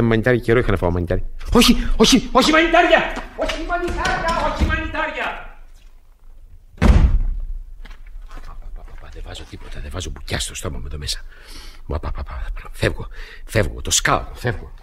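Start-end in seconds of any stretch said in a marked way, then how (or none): 1.5-5.6 high, frantic cries of no; suddenly terrified of the food; much higher panicked refusal; panicked protest. Acted. Panics at mushroom poisoning idea. 6.8-14.7 hushed muttering. Acted. Mutters, deciding to flee.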